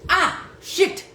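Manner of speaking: high startled curse; startled cry. Retold or imitated. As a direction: yelp as the ball hits. Hit by dodgeball in story.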